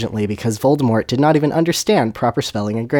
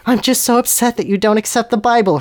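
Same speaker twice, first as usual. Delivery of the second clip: high-pitched mock distress; hammed-up, high, upset girl voice; feminine sobbing; 10 semitones higher than usual. Hermione pleads for the Bible, in a mock sob. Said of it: "Hermione sobbed femininely".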